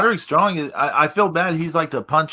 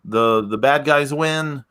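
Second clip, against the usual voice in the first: usual speaking voice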